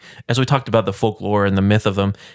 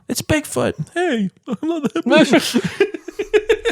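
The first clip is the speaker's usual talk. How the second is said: very high squeaky mock Bigfoot, laughing; silly falsetto monster through giggles; much slower than usual. Joking about Bigfoot with friends, squeaky mock voice.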